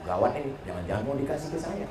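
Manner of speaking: soft, quick muttered joke; a sly under-the-breath quip; quiet aside. Acted. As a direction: drop to a hushed, fast aside. Joking aside to the audience.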